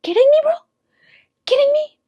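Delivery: high, loud disbelief; are you kidding me, shrieked; high-pitched outraged disbelief; incredulous outburst. Real, not acted. Outraged at boyfriend leaving.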